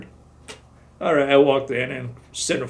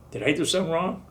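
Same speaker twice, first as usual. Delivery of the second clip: ordinary talk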